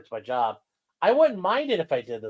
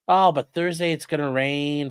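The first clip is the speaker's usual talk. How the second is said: usual speaking voice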